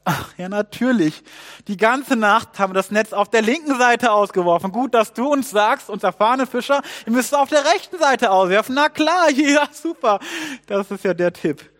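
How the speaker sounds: loud, high, exaggerated scoffing; indignant old hand being told the obvious; mocking sarcasm. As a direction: build to a scornful fake agreement with a laugh. Fishermen scoff at the stranger's tip.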